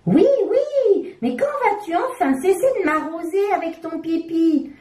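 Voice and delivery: insist, losing patience; exasperated diaper. A narrator's reading. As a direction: diaper scolds Coco, high and exasperated.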